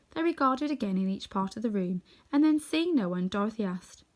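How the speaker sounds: plain reading voice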